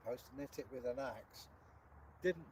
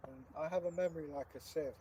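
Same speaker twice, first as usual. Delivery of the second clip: usual speaking voice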